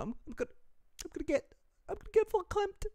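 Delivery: hammed-up sobbing breakdown, voice cracking upward; mock breakdown. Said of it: "I'm gonna get too emotional".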